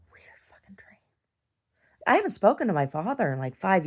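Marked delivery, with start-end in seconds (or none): 0.1-1.0 breathy whisper to herself; whispered aside. Real, not acted. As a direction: mutter it under the breath. Aside after telling the dream.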